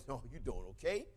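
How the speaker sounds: low, soft, deadpan question; deadpan low mock voice of Dave; Dave's low reply. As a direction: drop low and quiet as the tall athlete.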